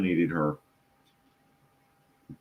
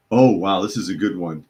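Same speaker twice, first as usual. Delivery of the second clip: normal talking voice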